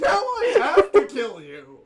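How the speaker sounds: loud, high threat through laughter; laughing mock threat. Player jokingly threatens the cab driver, laughing shout. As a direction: shout it while cracking up.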